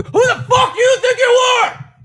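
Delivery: very high, loud furious shout; turned-on rage. Shown as a demonstration. Showing viewers how to turn it on, furious shout. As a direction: explode into a full yell on cue. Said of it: "I know how to turn it on".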